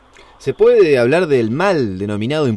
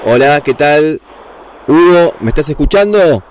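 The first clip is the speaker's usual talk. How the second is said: louder, calling into a silent line; 5 dB louder than usual. Real, not acted. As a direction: call out to check he hears. Calling guest over dead line. (llamando en voz alta)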